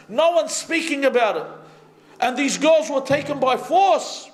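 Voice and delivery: high-pitched, urgent sermon delivery; impassioned preaching. Real, not acted.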